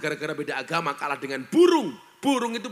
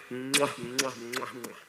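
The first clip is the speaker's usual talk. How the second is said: kissing sounds; 6 semitones lower, 6 dB quieter, slower than usual. Retold or imitated. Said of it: "Tanganku diambung bolak-balik".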